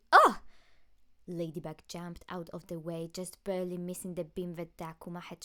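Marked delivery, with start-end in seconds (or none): 0.1-0.4 sudden high, very loud yelp; startled cry. Acted. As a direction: yelp as a beam nearly hits you. Dodging a sudden beam.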